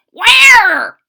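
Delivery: high, loud eager where; eager question. Acted. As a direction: demand it with hungry excitement. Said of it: "asked the old man".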